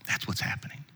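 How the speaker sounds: breathy whisper, no voice. Real, not acted. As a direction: lean in and whisper it. Whispered punchline after quote.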